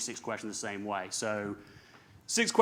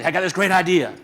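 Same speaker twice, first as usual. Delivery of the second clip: high, loud eager pitch of a new idea; excited pitch; 7 semitones higher, 12 dB louder than usual. Retold or imitated. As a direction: burst in with a big idea, much louder and higher. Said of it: "I could come to my brother and say".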